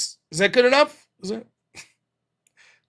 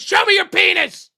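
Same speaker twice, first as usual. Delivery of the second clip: mock caller shout; 13 semitones higher, 6 dB louder than usual. Mocking a caller's demand.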